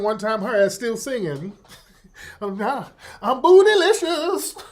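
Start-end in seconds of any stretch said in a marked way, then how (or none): 2.4-4.6 high, loud mock diva line; laughing diva caricature. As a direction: belt it like a flustered pop star. Mocking [name] singing.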